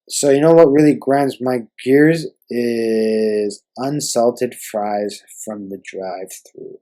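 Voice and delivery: slow, drawn-out rant opener; dramatic catchphrase. Acted. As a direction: lay the catchphrase down slowly.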